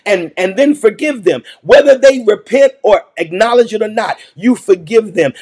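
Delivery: ordinary talk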